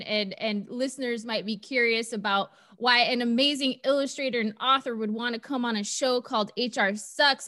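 ordinary talk

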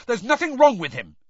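high, loud, indignant shout. A narrator's reading. Harry defends Hagrid in the pub, shouting. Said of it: "Harry shouted".

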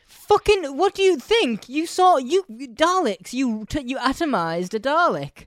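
high, loud incredulous yelling; exasperated Doctor rant. Retold or imitated. The Doctor scolding Rose over a gig, loud disbelieving rant.

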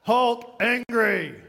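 loud, drawn-out mock monster roar; hammed-up angry brute voice; Hulk. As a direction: bellow it like an enraged comic-book brute. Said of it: "What is it, Hulk?".

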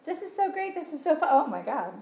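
high, gushing mock delight; excited gush. Fan recalling watching Muppets Haunted Mansion, excited gush. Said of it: "being like".

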